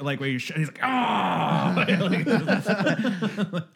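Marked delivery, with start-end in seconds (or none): 0.8-1.7 big high, loud growling yell; imitated angry battle yell. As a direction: roar like an angry, grizzled fighter. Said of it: "he's so grizzled and angry".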